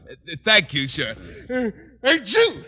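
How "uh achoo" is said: loud hammed-up sneeze, higher; fake sneeze. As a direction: sneeze it out for show.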